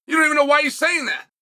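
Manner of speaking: ordinary delivery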